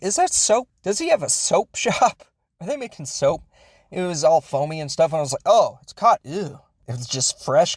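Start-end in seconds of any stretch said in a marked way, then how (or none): 0.0-3.4 higher, puzzled questions, half amused; retelling being baffled while watching, in a higher surprised voice; confused surprise. Retold or imitated. Retelling own confused reaction. 5.5-6.6 mild disgust. Retold or imitated. Retelling own disgusted realization.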